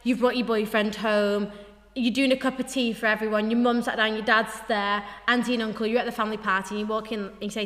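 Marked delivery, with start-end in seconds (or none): none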